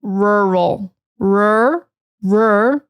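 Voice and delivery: "rural" slowly, then "rur" alone; careful pronunciation. Shown as a demonstration. Teacher showing a hard word, drawn-out rur. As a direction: hold the English r in "rur". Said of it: "it is pronounced rural".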